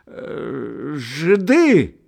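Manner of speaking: put-on loud, high drunken tale; drunken shout. A narrator's reading. Drunken Karas telling of the theatre, shouting. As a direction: bellow the story gloomily. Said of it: "мрачно крикнул опьяневший Карась".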